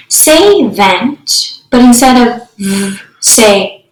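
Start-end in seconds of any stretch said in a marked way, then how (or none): 2.6-3.0 "v" as a held buzz; just the consonant "v", buzzing; isolated phoneme. Shown as a demonstration. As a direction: sound out "v", not "vee". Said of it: "substituting phonemes".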